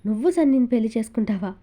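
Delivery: usual speaking voice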